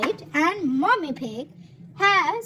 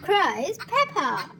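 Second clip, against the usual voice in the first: ordinary delivery, nothing marked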